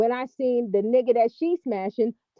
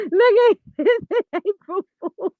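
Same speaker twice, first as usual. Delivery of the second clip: cracking up; 9 semitones higher, 4 dB louder than usual. Real, not acted. Cracking up at the impression.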